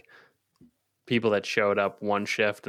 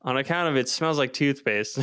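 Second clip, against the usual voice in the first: normal talking voice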